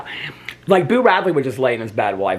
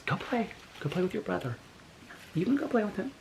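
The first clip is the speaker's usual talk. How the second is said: high soft coaxing; 8 semitones higher, 14 dB quieter than usual. Real, not acted.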